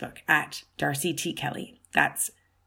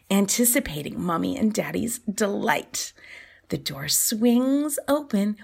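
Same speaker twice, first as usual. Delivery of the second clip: ordinary delivery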